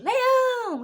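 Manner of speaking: put-on high, drawn-out children's answer; class chorus. Whole class answering the teacher's riddle, high chorus-style call. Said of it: "Todo mundo".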